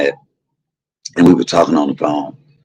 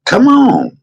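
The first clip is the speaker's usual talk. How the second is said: high exclamation. Real, not acted. Calling out to chat.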